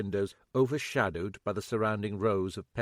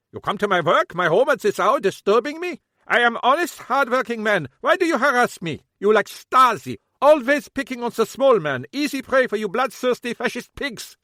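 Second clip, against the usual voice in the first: put-on high, loud accented tirade; furious German rant; 13 semitones higher, 11 dB louder than usual. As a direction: rail at the police like a wronged man. Kappelhoff rants at police.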